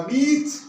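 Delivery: "बीच" with a stretched long ee; long vowel beach. Shown as a demonstration. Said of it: "ये sound थोड़ा long है".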